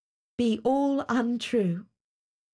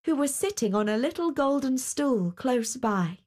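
plain reading voice

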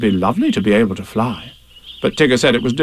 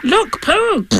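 very high, startled little squeak; small, jumpy creature voice; sudden Piglet. Acted. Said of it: "said Piglet suddenly".

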